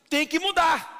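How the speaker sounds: loud insistent preacher's command; forceful preaching. Real, not acted. Pastor to the congregation, loud insistent command. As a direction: drive the demand home loud.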